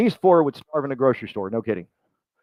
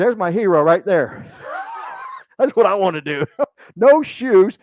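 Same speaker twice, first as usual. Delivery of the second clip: big laugh breaking into a shout; slow, laughing exclamation; 5 semitones higher, 4 dB louder than usual. Real, not acted. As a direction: cheer him on through laughter. Laughing about shoeless speaker hero.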